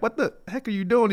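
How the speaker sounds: high-pitched, incredulous coach; exasperated coach. Retold or imitated. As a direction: imitate a coach demanding an explanation. Coach reacts to timeout in game.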